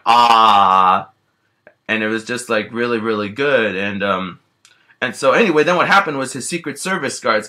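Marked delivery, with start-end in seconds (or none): 0.0-1.1 loud, contented bear groan over the steak; satisfied eating groan. Acted. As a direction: let out a big happy munching "Ah". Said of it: "I was going like".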